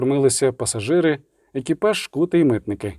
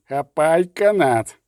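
a slower, milder second roar; 3 semitones higher, slower than usual. Acted. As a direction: repeat the order, urgent. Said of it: "ричав Ольсен".

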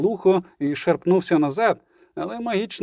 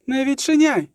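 higher-pitched urgent cry of warning; Ciri's cry; 9 semitones higher than usual. A narrator's reading. Ciri warns against opening the door, crying out. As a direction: cry out an order not to open. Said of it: "крикнула Цірі".

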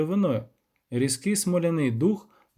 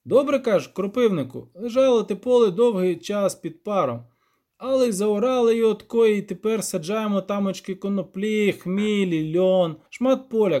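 higher, louder peasant storyteller; rambling villager in a pitched-up voice; rustic village elder; 6 semitones higher, 4 dB louder than usual. Acted. Elder describing farmland to guests, higher rustic voice.

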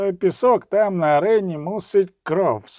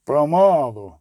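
slow, lightly bull-voiced roar; restrained bellow from a small burgomaster; roared caution; 3 semitones lower, 3 dB louder, slower than usual. A narrator's reading. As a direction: rein them in with a short roar. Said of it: "знову рикнув малий бургомістр".

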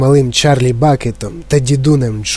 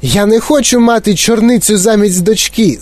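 high, outraged protest; crying out; 8 semitones higher than usual. A narrator's reading. Mother refuses a blueberry for a daughter, crying out. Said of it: "закричала пані Борегер".

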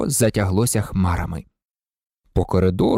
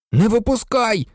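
sharp higher shout of warning; 10 semitones higher than usual. A narrator's reading. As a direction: yell to stop her. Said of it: "крикнув Рон". (гучний застережливий окрик)

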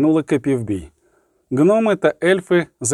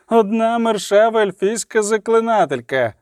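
high-pitched rallying cry, stretching out the final slogans; ringing metallic voice; 7 semitones higher than usual. A narrator's reading. Agitator urging the mob to stone the sorceress, ringing metallic cry. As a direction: incite the mob with high, long-held calls. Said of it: "звучний металевий голос".

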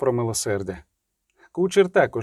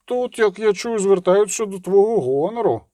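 high, sneering tease; mock-solemn taunt, pitched high; 6 semitones higher than usual. A narrator's reading. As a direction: jeer with curled lips. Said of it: "насмішкувато кривлячи губи".

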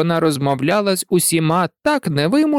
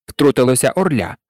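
ordinary delivery

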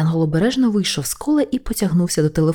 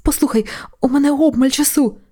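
whisper-shout; 7 semitones higher than usual. Acted. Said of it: "намагався шепотіти і кричати водночас".

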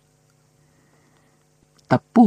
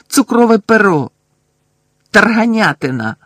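higher, louder angry yells of passwords; furious shouting; 8 semitones higher, 5 dB louder than usual. A narrator's reading. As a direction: fling each word out in rage, higher and louder. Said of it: "злісно закричав він".

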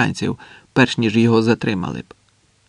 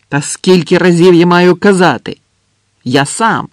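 loud, higher, fed-up retort; exasperated shout; 5 semitones higher, 7 dB louder than usual. Acted. Andres asked once more, exasperated shout. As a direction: fling the answer back, emphatically. Exasperated answer to repeated question.

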